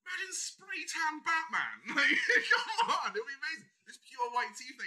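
high-pitched, laughing incredulity; can barely get it out for laughing; amused, laughing. Real, not acted. Cracking up at own idea.

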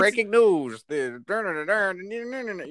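1930s radio newsreader; hammy breaking news voice; old radio announcer. Retold or imitated. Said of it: "radio broadcasts where it's like".

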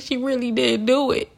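high-pitched mocking imitation; gleeful liar. Liar in the background enjoying the blame, gleeful. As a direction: gloat in a high put-on voice. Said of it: "they laughing in the background like".

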